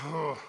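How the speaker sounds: exasperated, breathy "ugh"; frustrated sigh. Acted. Venting at an annoying spouse or friend, frustrated sigh. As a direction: let out a fed-up huff. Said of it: "We get a little frustrated".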